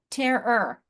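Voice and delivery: "terror" split clearly into TERR-ER; two syllables. Shown as a demonstration. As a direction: sound both syllables of "terror". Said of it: "It's two syllables".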